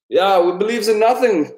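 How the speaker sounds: put-on German nihilist accent, high and loud; a hammed-up German nihilist accent. Quoting Lebowski nihilists in a German accent. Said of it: "my fucking German accent".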